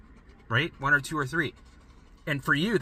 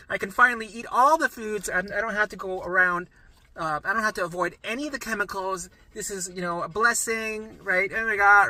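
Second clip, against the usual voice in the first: imitating tearful joy; 6 semitones higher, 3 dB louder than usual. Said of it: "And they're, like, crying".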